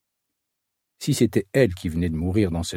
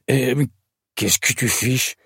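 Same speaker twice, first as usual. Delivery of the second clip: put-on rough, outraged old man's voice; stammer, then spit out the accusation; indignant cry; 2 semitones higher, slower than usual. A narrator's reading. Old man accusing the waitress of stealing, crying out indignantly. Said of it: "s'écrie-t-il".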